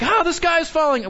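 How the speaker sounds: high sky-is-falling cry; mock alarm. Preacher imitates a panicking believer, mock alarm. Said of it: "Because then we're like".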